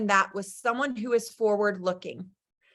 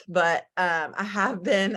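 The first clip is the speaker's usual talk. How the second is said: ordinary delivery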